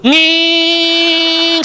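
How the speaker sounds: high nasal-free whine of dismissal; high dismissive hum; mock whining hum. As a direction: hum it high to wave someone off. Imitated brush-off noise.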